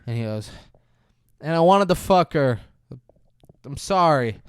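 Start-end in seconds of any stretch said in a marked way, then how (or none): 1.4-4.4 louder, higher acted confession; mock tearful confession. Stu confessing to Kelly, a louder mock tearful blurt. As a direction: blurt it out, imitating a crying man. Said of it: "he's crying".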